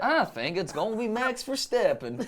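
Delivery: slow drawl with exaggerated jaw; Texas accent. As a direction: chew the words like a Texan. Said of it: "Do I do the accent?".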